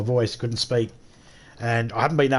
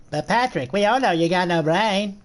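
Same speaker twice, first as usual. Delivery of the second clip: slightly raised cartoon voice, not quite landing; SpongeBob; 4 semitones higher than usual. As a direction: attempt a chirpy cartoon character. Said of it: "I can't do my SpongeBob voice".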